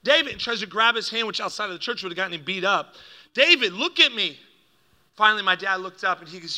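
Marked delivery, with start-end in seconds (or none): 0.0-0.3 high, loud call to halt; urgent interruption. Retold or imitated. Pastor tries to stop dad throwing money, urgent. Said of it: "trying to get his attention". 3.3-4.4 high, loud demand for attention; urgent command. Retold or imitated. Pastor trying to reach distraught dad, urgent. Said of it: "trying to get his attention".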